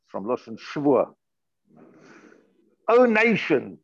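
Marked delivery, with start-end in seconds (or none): 2.9-3.8 mock archaic. Shown as a demonstration. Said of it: "Did anybody ever speak like that today?".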